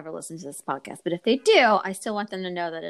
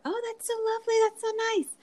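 much higher, sugary-sweet voice of the couple; faster than usual. Retold or imitated. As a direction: mimic their syrupy politeness. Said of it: "they were like".

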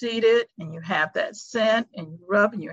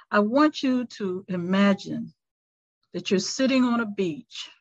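guided meditation. Acted. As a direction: ease them into stillness, unhurried. Leads a five-minute meditation.

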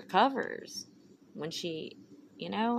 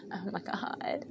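laughing whisper; 6 dB quieter, slower than usual. Real, not acted. Laughs at herself.

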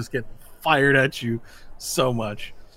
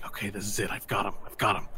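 low, hushed pep talk to himself; soft, low muttered resolve; tense self-talk; 4 semitones lower, 8 dB quieter, faster than usual. Acted. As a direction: psych yourself up under your breath. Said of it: "you can hear his voice going".

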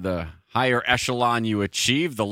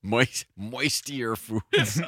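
laughing; 4 dB quieter, much slower than usual. Real, not acted. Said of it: "echoing with a laugh".

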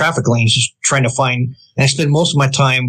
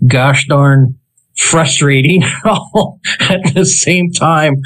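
slow, exasperated with a laugh; laughing frustration; 2 semitones higher, much slower than usual. Real, not acted. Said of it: "that's why I rant".

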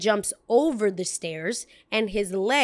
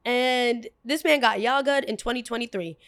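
normal talking voice

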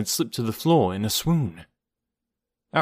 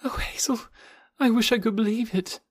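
moaning; 12 semitones higher than usual. A narrator's reading. Jane unable to believe her friend, moaning in distress. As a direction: moan it weakly, full of grief.

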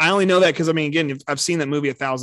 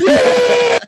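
much higher, loud whoop; excited shout; 10 dB louder than usual. Pretend. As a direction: cheer it out wildly. Mock cheering at a death.

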